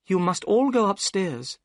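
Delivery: put-on high, calm young woman's voice; steady and kind; Miss Morstan. A narrator's reading.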